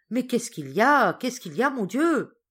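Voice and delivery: high and loud frightened questions; anxious outcry. Acted. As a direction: demand answers in alarm. Sister stamping, anxious from below.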